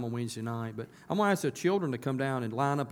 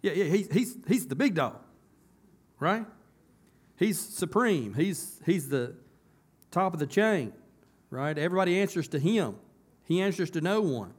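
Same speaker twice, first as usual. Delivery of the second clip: higher and louder, building up, preaching with fire; the pastor preaching God's supremacy at full force, higher and louder; 6 semitones higher, 6 dB louder than usual. Acted. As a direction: drive it home to the congregation.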